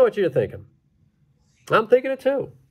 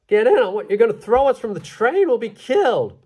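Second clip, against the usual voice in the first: alarmed; 6 semitones higher than usual. Acted. Passengers alarmed by mail bags.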